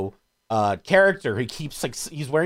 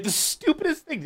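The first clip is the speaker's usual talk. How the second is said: much higher, delighted squeal; gleeful disbelief. Real, not acted. Raving to friends about the dumbest movie payoff. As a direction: gush over how dumb it is. Praising the slippery shoes payoff.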